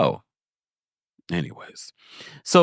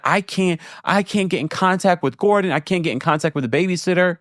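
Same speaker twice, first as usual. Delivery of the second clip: higher-pitched imitation of a complaint; mock distress; 7 semitones higher than usual. Recapper imitating Mia unable to reach Gordon, mock distressed. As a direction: relay her worry in a raised, put-on pitch. Retells Mia pleading she can't reach them.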